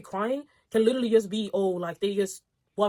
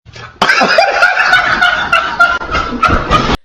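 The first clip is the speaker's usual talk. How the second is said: shrieking laugh; 15 dB louder than usual. Real, not acted. Shrieking laugh at own joke.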